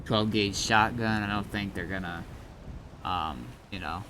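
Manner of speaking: normal talking voice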